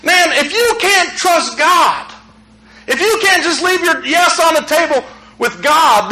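ordinary talk, nothing marked

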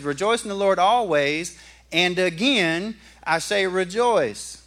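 slow, drawn-out "and again, I say rejoice"; emphasized repetition. Shown as a demonstration. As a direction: lean on the repeated rejoice. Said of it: "If he says it twice".

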